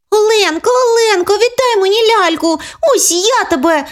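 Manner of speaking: put-on high, chasing child's cry; tearful angry little girl. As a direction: run after her and threaten. Sister chases in tears.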